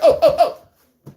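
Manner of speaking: slow, high mock yelps of pain through a laugh; bacon grease yelps. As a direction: act out getting hit by hot grease, laughing. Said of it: "that shit like".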